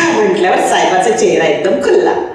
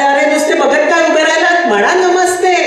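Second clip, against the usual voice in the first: higher, brisk teacher prompting; eager urging; 7 semitones higher than usual. Acted. Teacher urges pupils to greet the boss, brisk and high. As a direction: hurry the kids to greet.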